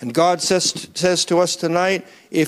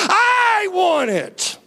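impassioned cry; 12 semitones higher, much slower than usual. Acted. Voicing hungry churchgoer, climax.

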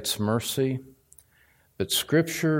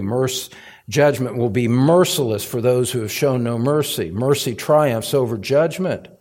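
ordinary delivery

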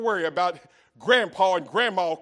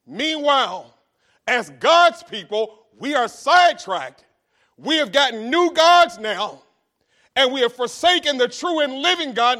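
loud, rising preacher's cry; preaching at full force; 5 semitones higher, 8 dB louder than usual. Real, not acted. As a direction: preach it out to the congregation.

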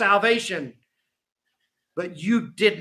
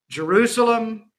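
calling out; 4 semitones higher than usual. Acted. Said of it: "Jesus calls out".

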